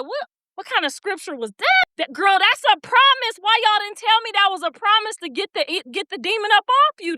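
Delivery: high, lively amazed outburst; can't believe no one told her; excited disbelief. Real, not acted. Tells viewers about the scripture, excited.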